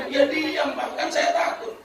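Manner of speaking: high, lightly voiced frightened wife; mock-fearful wife defending herself; timid wife. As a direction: make a meek, high-pitched excuse. Wife excuses herself to husband.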